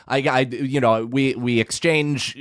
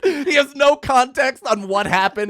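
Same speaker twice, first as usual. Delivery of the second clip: laughing; 11 semitones higher, 3 dB louder than usual. Real, not acted. Laughing while telling.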